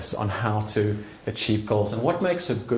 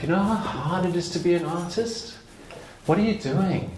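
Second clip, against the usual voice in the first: a scoffing friend, pitched up by the end; mocking doubter; 5 semitones higher than usual. Friend scoffing at my art, mocking doubt. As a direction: sneer at the artist's dream. Acted dismissive friend's put-down.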